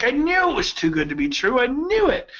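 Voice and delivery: high, rising protest; mock told-you-so. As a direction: imitate a friend's aggrieved "I knew it". Friend reacts to lower figure.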